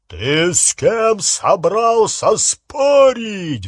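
loud, high, drawn-out bellow; angry roar. A narrator's reading. Harry confronts a wizard who argues, angry roar. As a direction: roar it slowly to intimidate an underling. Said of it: "взревел Гарри".

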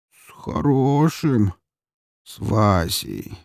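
put-on very slow, dopey drunk voice; slow drunken answer. A narrator's reading. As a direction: drag the words out vacantly. Drunk keeps answering dimly.

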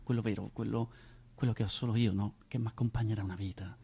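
normal talking voice

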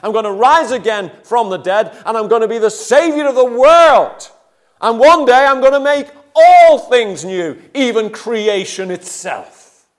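high, loud, exasperated rebuke; Jesus rebuking disciples. Retold or imitated. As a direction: scold them loudly for doubting. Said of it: "imagine the Lord Jesus saying to them".

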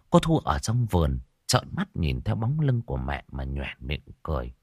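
usual speaking voice

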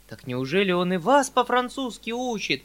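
high, startled question; almost frightened exclamation. A narrator's reading. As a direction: cry out with a touch of alarm. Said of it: "вскричал я почти в испуге". (возглас с лёгким испугом)

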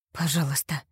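hoarse, breathy plea; hoarse whisper. Acted. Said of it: "прохрипела я". (хриплым шёпотом)

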